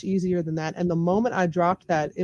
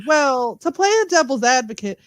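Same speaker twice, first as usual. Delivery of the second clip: higher, louder mock objector; mock devil's advocate; 7 semitones higher, 5 dB louder than usual. Imitating online folks who play devil's advocate, mock objecting. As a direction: put on a contrarian's "well, actually" pitch. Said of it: "who like to play devil's advocate and say".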